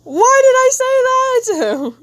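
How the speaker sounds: a squeaky high disbelieving shriek, slowly; shocked at herself. Retold or imitated. Artist reading her old caption, shrieking in disbelief. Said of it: "I'm like".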